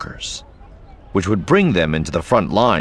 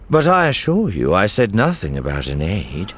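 usual speaking voice

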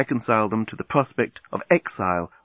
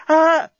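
short, high, loud "ah"; passionate moan; 7 dB louder than usual. Acted. Lucy moaning to the journalist, passionate cry.

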